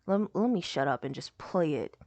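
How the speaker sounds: ordinary talk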